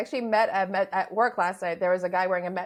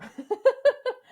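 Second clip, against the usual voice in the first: high laugh; 4 dB louder than usual. Real, not acted. Laughing at marble bit.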